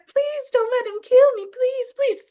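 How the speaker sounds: high, loud, whiny begging for mercy; mock pleading. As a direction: Haman begging the king, hammed-up high pleading. Haman pleading for his life.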